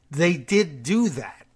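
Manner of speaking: ordinary delivery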